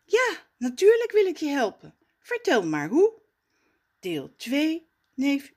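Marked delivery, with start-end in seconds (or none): 0.0-3.1 friendly helper voice. Acted. Storyteller voices a friendly figure.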